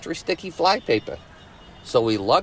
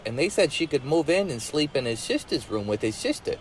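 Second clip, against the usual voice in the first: ordinary delivery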